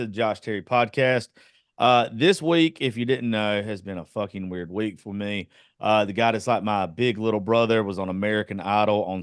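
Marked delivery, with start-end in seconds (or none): none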